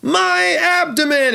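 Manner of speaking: high, drawn-out theatrical wail; hammed-up stab victim. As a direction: cry out in badly acted agony. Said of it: "and she goes".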